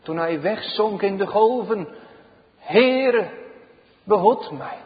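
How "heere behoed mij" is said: high loud cry on Heere, then falling; acted plea from the water; Peter's cry. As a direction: call out as if sinking in the waves. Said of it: "Zoals ook een Petrus het uitriep".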